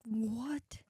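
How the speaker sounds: real hushed, voiceless disbelief; stunned whisper. Real, not acted. Listener stunned by the ghost story, real whisper. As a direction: whisper it in astonishment.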